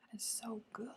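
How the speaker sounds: soft breathy whisper of bliss; whispered delight. Real, not acted. Savoring a snack alone on camera, whispering. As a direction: whisper it like the taste is too good.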